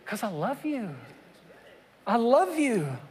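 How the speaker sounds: soft, hushed I love you; Father answering his child; tender father. Acted. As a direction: whisper it gently. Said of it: "Oh, but why?".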